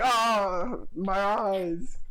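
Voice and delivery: a guard wailing about his stinging eyes; pained guard. Acted. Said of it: "He's like".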